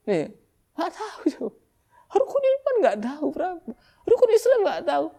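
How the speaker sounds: high, whiny imitation of a kid not knowing; mock clueless student. Preacher mimics schoolkids who don't know, high and whiny. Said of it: "ada yang jawab".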